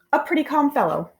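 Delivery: ordinary delivery, nothing marked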